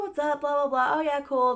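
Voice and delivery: high, airy mock chatter; dismissive imitation of her. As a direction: brush someone off in a silly high voice. Mocking roommate's chatter.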